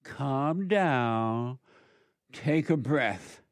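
low, soft and slow; soothing, unhurried low voice; calming. Real, not acted. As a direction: talk them down gently. Calming an angry man.

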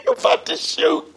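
high incredulous question through laughter; can't believe it's over clippers; laughing disbelief. Retold or imitated. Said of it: "I'm like".

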